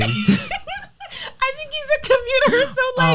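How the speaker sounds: high-pitched, slowed by laughter; laughing. Real, not acted. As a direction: squeeze the words out through laughter. Cracking up while describing the classmate.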